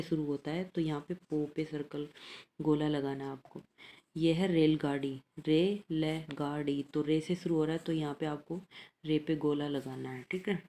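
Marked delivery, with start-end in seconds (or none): 5.5-6.8 रे ल गाड़ी split slowly into separate syllables. Shown as a demonstration. Teacher breaking रेलगाड़ी into syllables for pupils, slow. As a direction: spell out रे ल गाड़ी slowly for children. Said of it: "teacher splits word into syllables".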